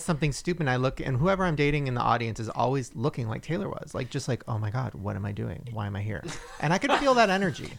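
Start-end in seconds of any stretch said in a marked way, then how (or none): 4.5-6.2 low, soft imitation of inner dread; mortified muttering. Voicing an embarrassed date in the audience, low mortified mutter. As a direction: mutter it under your breath, lower and quieter. Voices embarrassed partner's silent thoughts.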